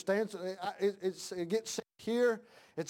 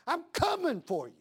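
higher threat, leaning on coming; preaching threat; 6 semitones higher than usual. Real, not acted. Father warning whoever would hurt his boy, high and firm.

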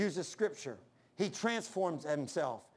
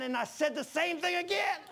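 exasperated punchline shout; 9 semitones higher than usual. Real, not acted. Preacher confessing his slip to the congregation, exasperated shout. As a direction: cry it out, high and incredulous.

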